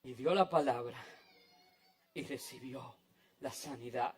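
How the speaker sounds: very low and soft, with pauses; near-whispered, reverent conclusion; hushed awe. Real, not acted. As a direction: let the outcome land quietly. Hushed outcome after the climax.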